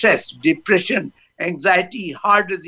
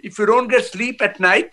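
normal talking voice

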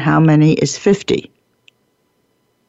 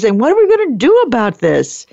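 higher, anxious do-gooder voice; mock worried teammate, pitch climbing; fretting rower; 8 semitones higher than usual. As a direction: play the rower wringing hands over the grumblers. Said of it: "You have another meeting with the rowers".

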